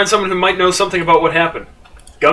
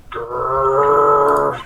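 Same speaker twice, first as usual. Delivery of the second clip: tiger growl; 4 semitones lower than usual. Acted.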